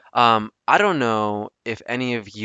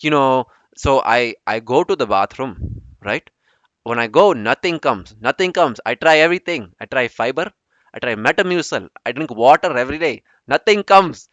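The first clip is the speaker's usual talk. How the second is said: louder, hammed-up Indian accent; 3 semitones higher, 5 dB louder than usual. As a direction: complain emphatically in a mock Indian accent. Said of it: "I always go with the Indian accent".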